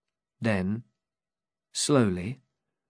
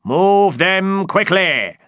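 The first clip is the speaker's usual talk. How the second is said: loud, slow, high shout; shouted order; 9 semitones higher, 8 dB louder, slower than usual. Acted. Knight shouts at the driver, loud and slow. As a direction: bark the order with full force. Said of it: "shouted Don Quixote".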